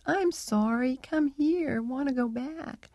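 a lightly voiced sad parrot begging not to be left; pitiful little voice. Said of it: "in this pitiful little voice".

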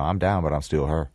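"still her" loosened and mumbled in Memphis speech; mumbled Memphis accent. Shown as a demonstration. Director shows actor the mumbled Memphis line. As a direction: slur "I'm down, but I'm still her" loosely, Memphis style. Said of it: "put a mumble to it".

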